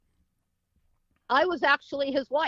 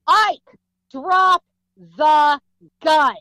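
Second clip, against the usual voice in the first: shouted command; 2 semitones higher, 10 dB louder, much slower than usual. Retold or imitated. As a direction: call it out as a stern order, word by word. Retold command to a gunman.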